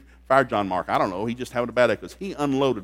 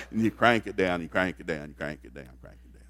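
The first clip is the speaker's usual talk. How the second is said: each crank it down softer and lower until almost gone; fading away; 9 semitones lower, 6 dB quieter than usual. Retold or imitated. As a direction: let the words die out as the bucket sinks. Repeated cranking of the well.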